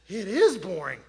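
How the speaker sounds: IS punched loud; emphatic agreement. Retold or imitated. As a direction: hit "is" hard, in loud exasperated agreement. Retold agreeing emphatically during break.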